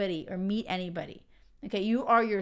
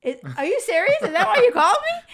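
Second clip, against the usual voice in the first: much higher and louder, laughing disbelief; amused disbelief; 11 semitones higher, 10 dB louder, faster than usual. Real, not acted. Host reacting to her boyfriend's confession, high loud disbelief. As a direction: react to his confession with delighted shock. Boyfriend admits why he called.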